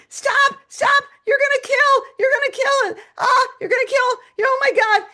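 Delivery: high, loud frantic screams; screaming. Retold or imitated. Owner chasing cats after a mouse, frantic screaming. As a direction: shriek as if chasing to stop a killing. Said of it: "I'm screaming".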